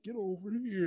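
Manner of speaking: mock whisper. Fan saying the line for the silent character, mock whisper. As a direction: say the battle cry under your breath. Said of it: "You whisper".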